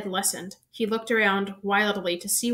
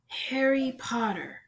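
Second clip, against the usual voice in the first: eerie whisper; 4 semitones higher, slower than usual. A narrator's reading. Voldemort's face greeting Harry, slow eerie whisper. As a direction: breathe his name slowly, menacing. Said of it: "it whispered".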